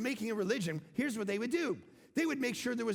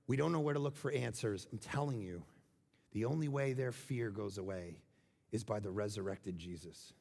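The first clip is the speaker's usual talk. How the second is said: intimate preaching; 8 semitones lower, 3 dB quieter than usual. Acted. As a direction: draw the room in close and quiet. Sermon drops to intimate preaching.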